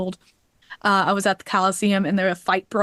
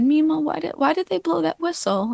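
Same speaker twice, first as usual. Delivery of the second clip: lightly voiced child, higher pitched; a higher, questioning kid voice; curious little kid; 7 semitones higher than usual. Little kid asking grandma about the whistle, lightly childlike and higher.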